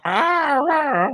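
drawn-out, high-pitched "ah"; playful imitation of a moan; long high mock moans; mock pleasure moan. Mimes noises from prostitute visits.

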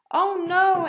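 high, loud mock groan; groaning dismay. Ava reacts to the loud park, groaning loudly.

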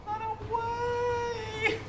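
high drawn-out panicked wail; panicked cry. Real, not acted. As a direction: flee in a high wailing cry. Fleeing boss, high cry.

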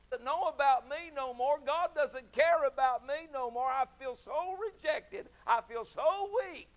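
mock self-pity.

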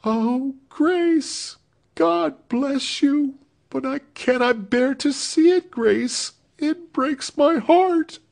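put-on high, slow, tearful young woman; sobbing. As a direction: break down into sobs. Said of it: "fell sobbing on her neck".

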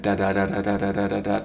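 every "da" identical, on one pitch; stagnant, no accents. Shown as a demonstration. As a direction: rattle off "da" evenly like a machine. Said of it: "we just get stagnant".

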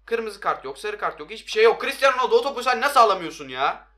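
loud, high outraged shouting; furious protest. Real, not acted. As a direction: yell at the player in disbelief. Raging at missing card and player.

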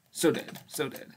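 ordinary talk

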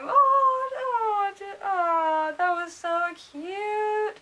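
high, melting coo; drawn-out adoring "aww" voice; gushing. Real, not acted. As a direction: swoon over how adorable it is. Gushing over a favorite proposal.

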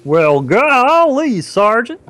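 slow golly-gee drawl, high; Gomer Pyle. Shown as a demonstration. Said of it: "Borderline Gomer Pyle".

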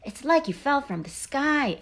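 high-pitched amazed exclamation; storybook frog's astonished outburst; exclaiming. A narrator's reading. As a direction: exclaim it in wonder, pitch leaping. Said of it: "exclaimed the yellow frog".

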